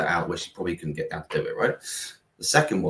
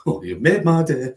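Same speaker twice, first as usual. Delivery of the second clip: higher, louder put-on grandma voice; imitated northern grandma; 7 semitones higher, 6 dB louder than usual. Grandma answering her grandson's goodbye, imitated northern voice. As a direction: exclaim it as a delighted northern grandma.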